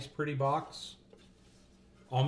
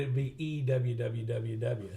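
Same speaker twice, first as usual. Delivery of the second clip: usual speaking voice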